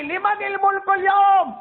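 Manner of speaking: high, slow shout of the question; booming divine call. Acted. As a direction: thunder it out as God's voice. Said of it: "اللہ کی آواز آئے گی".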